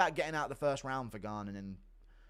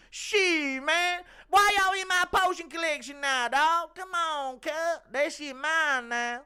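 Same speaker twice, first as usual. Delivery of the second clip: much higher, loud street-slang voice; modern-day Snape; 8 dB louder, slower than usual. Retold or imitated. Streamer's modern Snape scolding a potion thief, high and loud. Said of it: "actual modern-day Professor Snape impression".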